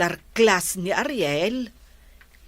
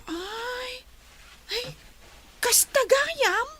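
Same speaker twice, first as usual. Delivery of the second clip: surprised; much slower than usual. Acted. Surprised at news.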